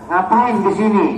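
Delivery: loud, high-pitched challenge; mock exasperated questioning; exasperated doctor. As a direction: demand it like an impatient doctor. Acted doctor questioning a silent patient.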